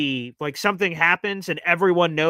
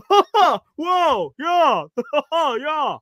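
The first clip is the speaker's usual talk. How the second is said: high, loud hammed-up whoa and cackle; mock an obnoxious know-it-all fan; 10 semitones higher, 5 dB louder, much slower than usual. Narrator mocking the old man beside him, high obnoxious whoops. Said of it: "he would obnoxiously go".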